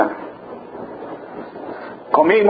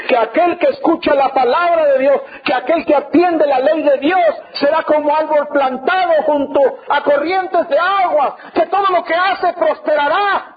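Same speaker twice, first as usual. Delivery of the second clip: insistent, rising sermon delivery; preaching; 3 semitones higher than usual. Retold or imitated. Preacher quotes scripture to an unbelieving husband, fervent preaching. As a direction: build the scripture promise like a preacher.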